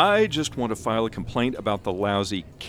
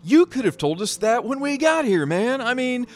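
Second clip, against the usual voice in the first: exasperated rant; 10 semitones higher, 5 dB louder than usual. Acted. Chuck ranting at Tom about wasted time, exasperated shout. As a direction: vent full frustration at being tricked.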